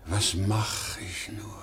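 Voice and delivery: soft, slow, helpless murmur; lost despair. Acted. Fired salesman alone, quiet slow despair. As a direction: ask it to nobody, utterly lost.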